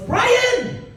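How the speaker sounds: high shout of Brian; shouting a name. Retold or imitated. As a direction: call out sharply for Brian. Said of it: "the woman goes".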